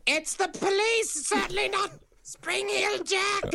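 high, slow fake-innocent voice; high sneaky villain voice. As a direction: pose as police, badly. Jack fakes being police.

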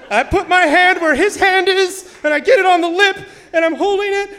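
very high, loud, strained recounting; panicked retelling. Retold or imitated. Child takes the big catfish from grandpa, high-pitched panic. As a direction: push it up high, like a frightened kid. Acting terrified child grabbing fish.

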